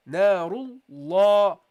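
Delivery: Narullahi slow, heavy full lam; teacher modelling Narullahi; slow recitation. Shown as a demonstration. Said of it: "Okay, narullahi".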